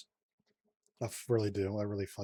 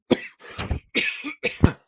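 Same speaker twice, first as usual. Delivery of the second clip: sudden choking cough fit; 9 dB louder than usual. Real, not acted. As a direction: cough hard on food down the wrong pipe. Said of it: "choking on some mashed potatoes".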